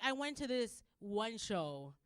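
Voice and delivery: usual speaking voice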